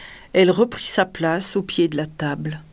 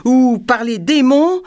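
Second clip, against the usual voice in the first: loud, high-pitched oath; cried threat; 6 semitones higher, 6 dB louder than usual. A narrator's reading. Monstrous king threatening the hesitant dwarf, shouting. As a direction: swear it out as a threat. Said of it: "cria le monstre".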